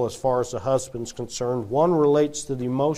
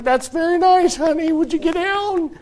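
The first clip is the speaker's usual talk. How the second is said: high, strained mom voice with a laugh; nervous mom; 13 semitones higher, 5 dB louder than usual. Retold or imitated. Mother tells her dangling son to get down, high and tense. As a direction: humour the kid while begging him down. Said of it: "she's like".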